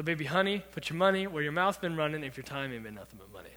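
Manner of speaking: normal talking voice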